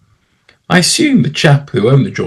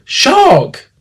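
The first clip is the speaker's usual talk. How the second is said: high-pitched cry of "Shark!"; distant swimmer's warning, pitched way up. Acted. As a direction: call the alarm high, at no great volume. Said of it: "then I heard the word".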